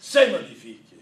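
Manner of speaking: loud, hammed-up French flourish; mock French accent. Shown as a demonstration. Speaker showing off his French vocabulary in a loud mock accent. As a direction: proclaim it grandly, fake French. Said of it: "my entire French vocabulary".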